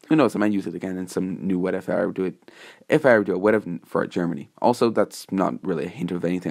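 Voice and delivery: plain reading voice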